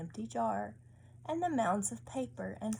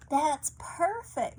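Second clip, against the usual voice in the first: louder, slow and pleased; delighted mama; 7 semitones higher, 7 dB louder, slower than usual. A narrator's reading. Mama praising Luca's gift, slow and pleased. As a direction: savour the words with delight.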